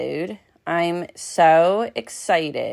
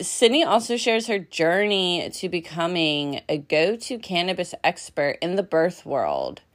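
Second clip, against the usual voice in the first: ordinary talk, nothing marked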